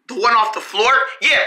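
ordinary talk, nothing marked

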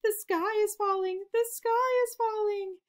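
high, loud panicked cry of a frightened chick; panicked scream. A narrator's reading. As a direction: shriek it like the sky is really falling. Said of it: "Dizzy Ducky screamed as they ran".

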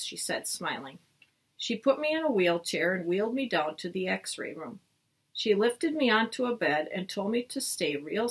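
expressive storybook read-aloud; reading to children. Acted. Teacher reading a chapter book to the class, lively storytelling.

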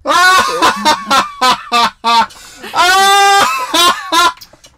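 loud high cackle; shrieking laugh. Real, not acted.

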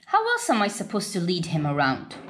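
ordinary delivery

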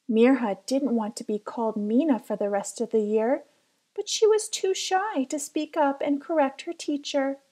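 plain reading voice

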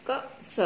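'क' and 'स' as two separate sounds; phonic X. Shown as a demonstration. Teacher showing children X, separate phonic 'क', 'स'. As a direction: split X into 'kuh', 'suh'. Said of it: "एक्स का sound होता है क".

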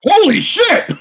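high, loud exclamation; real disbelief, blurted; shocked. Real, not acted. Shocked by the quiz answer.

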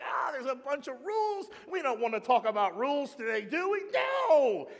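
high, hammed-up cry of frustration; mock exasperated outcry. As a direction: climb to a comic shouted refusal. Acts out frustrated outcry over rules.

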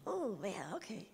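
soft whispered realization, barely voiced; a hushed, stunned oh yeah okay; shaken whisper. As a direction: breathe it as it hits you. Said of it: "It's like".

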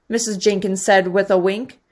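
usual speaking voice

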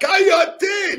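high, loud mock shout of his wife; high-pitched outburst in a retold quarrel; shouted command. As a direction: yell it sharply, imitating an angry wife. Wife yelling back in hallway quarrel.

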